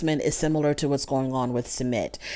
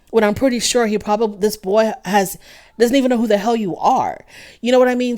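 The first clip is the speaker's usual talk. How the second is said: rising heat, louder and higher as the rant builds; really getting fired up at the show; getting worked up; 5 semitones higher, 5 dB louder than usual. Real, not acted. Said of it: "I'm getting worked up".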